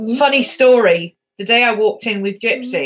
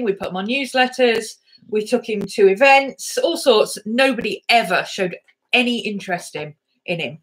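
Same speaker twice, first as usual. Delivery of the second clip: normal talking voice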